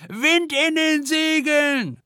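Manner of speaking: very high, slow screeching shout; shrieking. A narrator's reading. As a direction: shriek it into the storm. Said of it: "kreischt Silja".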